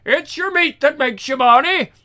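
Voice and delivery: a Scotsman's hammed-up yell; shouting Scotsman. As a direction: bellow it like a caber tosser. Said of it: "tossing their caber and shouting".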